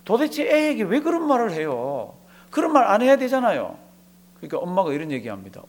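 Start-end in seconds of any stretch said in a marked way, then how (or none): 0.0-3.8 acting out an upset son, higher pitch; retold indignant protest. Son taking his mother to task over what she told his child, indignant. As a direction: confront her in a raised, pleading-to-reason pitch. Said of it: "아들이 엄마한테 막 따집니다".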